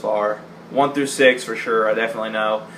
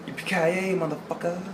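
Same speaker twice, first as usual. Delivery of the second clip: a hint of a cool McClane, much softer; soft Yippee-ki-yay; 3 semitones higher, 9 dB quieter than usual. Quoting Bruce Willis's catchphrase, softly and smugly. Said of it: "that is the version I prefer".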